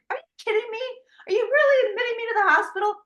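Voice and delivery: can't believe they're keeping her, voice jumping high; incredulous. Retold or imitated. Patient told she's admitted, high incredulous protest.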